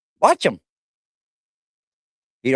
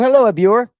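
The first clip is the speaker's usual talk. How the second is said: ordinary talk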